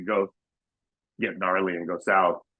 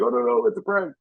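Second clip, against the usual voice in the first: quick high apologetic voice; high mock panic; 13 semitones higher, 3 dB louder than usual. Pranksters explaining to the cop, high mock panic. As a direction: scramble to explain, higher. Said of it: "we're like".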